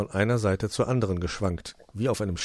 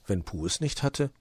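plain reading voice

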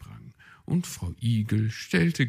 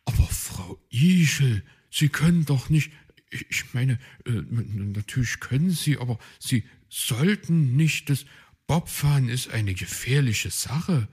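flustered, stumbling, louder; stammering; 5 semitones higher, 5 dB louder than usual. Acted. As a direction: stammer in a panic. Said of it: "stammelte Puh".

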